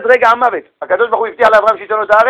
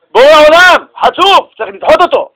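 raised, high, outraged; indignant angels; 8 semitones higher, 5 dB louder than usual. Acted. Ministering angels protest to God, outraged and loud. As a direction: cry out that he is insolent. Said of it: "מלאכי השרת ... ואמרו".

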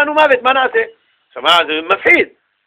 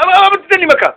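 put-on much higher-pitched child's protest; frightened child; 11 semitones higher, 4 dB louder than usual. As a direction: plead like a scared child, pitched way up. ילד מפחד שואל את אביו. (ילד מבוהל, בקול גבוה)

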